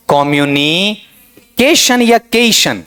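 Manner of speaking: कम्युनिकेशन slowly, opening on a full "kom"; first syllable stressed. Shown as a demonstration. Teacher asking what noun-form stress gives, first syllable stressed. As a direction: draw out कम्युनिकेशन, kom-yu-nee, then केशन. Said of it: "पहले लगाना चाहूं stress".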